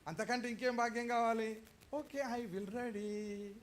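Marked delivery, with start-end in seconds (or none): 1.9-3.5 mock eager acceptance. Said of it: "అని రాజు అడగగానే okay".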